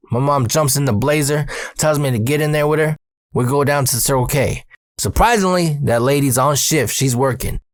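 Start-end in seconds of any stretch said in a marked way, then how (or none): none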